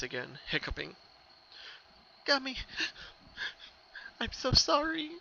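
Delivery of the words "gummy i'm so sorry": put-on high, teary voice of a young pony; grieving filly speaking to her lost pet, high and tearful; tearful apology. A narrator's reading. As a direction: choke out a soft, high apology through tears. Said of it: "she cried into her hoof".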